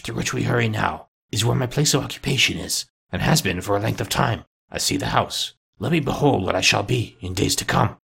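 put-on subdued old character voice, altered timbre; Scrooge, earnest and uneasy. A narrator's reading. Scrooge begging the spirit to show his future, subdued. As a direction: plead softly as an uneasy old miser.

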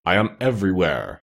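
mildly solemn unseen voice. A narrator's reading. Unseen Oz answers Dorothy from everywhere, solemn. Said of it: "they heard a solemn voice".

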